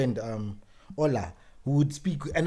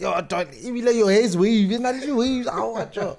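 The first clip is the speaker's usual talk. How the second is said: put-on high, loud Nigerian accent teasing; 9 semitones higher, 4 dB louder than usual. Teasing a friend about his wavy hair, loud Nigerian accent. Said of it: "speaking Nigerian accent".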